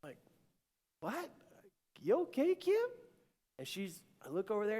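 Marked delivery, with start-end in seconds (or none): 1.0-2.8 high-pitched worried check-in; startled concern. Retold or imitated. Husband checks on his tearful wife, startled concern. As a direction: ask with sudden, high-voiced alarm. Husband alarmed by wife's noise.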